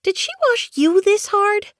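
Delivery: very high-pitched kitten whine; complaining kitten. A narrator's reading. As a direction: complain in a squeaky little kit voice. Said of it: "complaining to Blue Kit".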